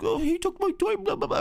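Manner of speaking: put-on high whiny little-girl tattling voice; whiny sister. A sister tattling to her parents, high and whiny. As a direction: whine it up high like a tattling kid. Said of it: "And then she's like".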